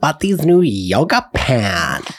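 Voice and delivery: slow, gushing mock Valley Girl; Valley Girl accent. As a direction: stretch every vowel, Valley Girl style. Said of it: "Valley Girl".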